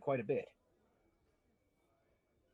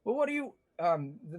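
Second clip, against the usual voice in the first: normal talking voice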